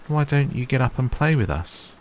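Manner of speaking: high, lightly voiced wild rabbit; lightly voiced rabbit coaxing a friend; wild rabbit asking. A narrator's reading. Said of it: "one of them asked".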